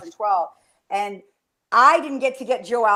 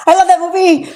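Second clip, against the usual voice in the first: much higher and louder, thrilled; gushing; 9 semitones higher, 7 dB louder than usual. Real, not acted. Fan host gushing to co-host about the movie, thrilled. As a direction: gush like a superfan.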